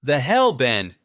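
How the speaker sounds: loud, high exclamation; shout. A narrator's reading. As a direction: yell in shocked disbelief. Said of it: "Peter shouted behind me".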